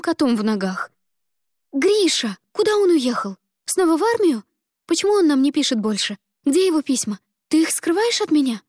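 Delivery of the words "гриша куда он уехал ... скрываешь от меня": high, loud, pleading questions; frantic questions. Acted. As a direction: press her with panic. Ada frantically interrogates mother.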